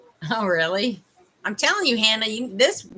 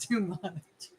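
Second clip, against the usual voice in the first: usual speaking voice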